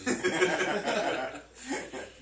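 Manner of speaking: loud high burst of laughter; big laugh. Real, not acted. Guest laughing at his own awkward plug, loud burst of laughter.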